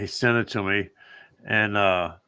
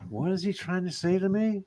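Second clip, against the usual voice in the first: higher, softer puzzled musing; 8 semitones higher, 6 dB quieter than usual. Retold or imitated. Man reading a client's text to himself, puzzled. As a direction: wonder aloud, pitched up and quiet.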